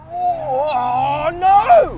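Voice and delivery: high, loud cry of dismay; dismayed shout. Real, not acted. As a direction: yell out in shock. Losing the roll race.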